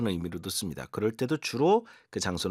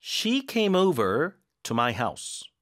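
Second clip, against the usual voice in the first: very slow, stress on "came"; slow read-along; 4 semitones higher, much slower than usual. Shown as a demonstration. Teacher reading aloud for students to repeat. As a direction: say it slowly for students to echo. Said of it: "어디에 힘을 줘야 되는지 한번 잘 따라 읽기".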